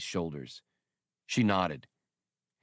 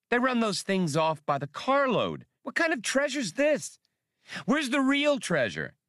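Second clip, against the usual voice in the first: much higher, incredulous complaint; indignant smuggler; 4 dB louder than usual. Acted. Han complaining to Skinks about fake treasure, incredulous. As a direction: scoff at the worthless haul.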